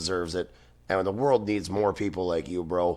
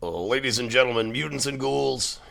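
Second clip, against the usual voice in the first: hoarse, croaky announcer intro; 6 semitones higher, 3 dB louder than usual. Real, not acted. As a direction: rasp out a showman welcome. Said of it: "I sound like a ghoul".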